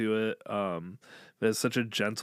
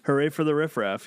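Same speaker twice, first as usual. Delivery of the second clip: cheery announcement; 6 semitones higher, 7 dB louder than usual. Acted. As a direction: announce the band name with a cheer. Cheering the band name again.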